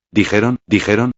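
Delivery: a touch of a catch in the voice; lightly voiced child faltering mid-word; voice breaking. A narrator's reading. Child retells her kidnapping, voice mildly breaking. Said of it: "su voz se quebró".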